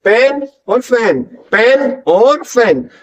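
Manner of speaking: slow पेन with lips pressed, फैन with teeth on lip; contrasting P and F. Shown as a demonstration. Teacher showing learners two sounds, slow distinct पेन and फैन. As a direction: set apart the P of पेन from the F of फैन. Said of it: "पी दोनों lips को बीच के निकल रहा है".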